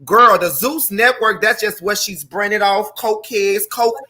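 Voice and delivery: normal talking voice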